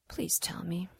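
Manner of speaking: pleading whisper, very soft. Acted. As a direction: breathe the plea. Coaxing ex for the stones.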